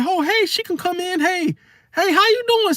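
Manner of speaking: very high, sing-out mock greeting; welcoming auntie. Aunt welcoming nephew's friend in, high-pitched and gushing. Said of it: "they'll say".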